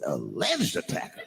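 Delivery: low, slow disbelieving question; incredulous. Retold or imitated. Comedian reacts to the headline about his attacker, incredulous. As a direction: question the word in deadpan disbelief.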